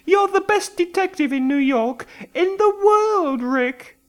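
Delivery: high anxious woman's voice; frightened woman. A narrator's reading. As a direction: plead with the detective for your life. Simone flattering the detective.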